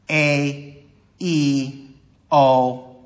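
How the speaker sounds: A, E, O sounded one by one; radio's vowels isolated. Shown as a demonstration. Teacher counting syllables in radio, each vowel sounded alone. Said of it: "three vowel changes".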